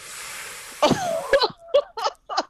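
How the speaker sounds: high burst of stunned laughter; shrieking laugh. Real, not acted. Laugh after hearing the message.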